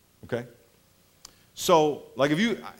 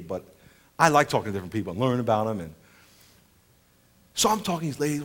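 usual speaking voice

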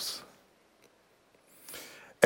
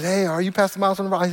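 higher, curious stranger voice; stranger's question; 5 semitones higher than usual. Retold or imitated. Stranger at the airport recognizing the pastor, higher voice. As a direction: ask like a fan. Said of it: "He says".

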